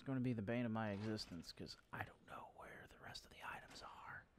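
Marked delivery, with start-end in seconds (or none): 1.5-4.3 quiet whispered aside. Real, not acted. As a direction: whisper the frustration.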